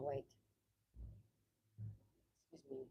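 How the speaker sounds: usual speaking voice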